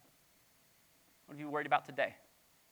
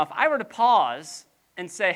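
usual speaking voice

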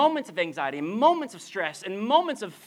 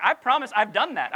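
ordinary talk, nothing marked

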